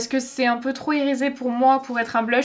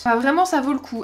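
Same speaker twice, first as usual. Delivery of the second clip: ordinary delivery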